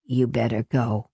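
low, subdued tired voice; tired man. A narrator's reading. Tired Renee urging Marie to leave, low and weary. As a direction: say it low and worn out.

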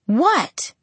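sharp high indignant what; demanding what. A narrator's reading. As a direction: demand it, startled. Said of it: "I demanded".

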